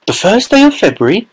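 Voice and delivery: high, startled cry of disbelief; cried out. A narrator's reading. Mother crying out in surprise at the ticket date. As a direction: Mrs Bucket gasping at the date. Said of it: "cried Missus Bucket".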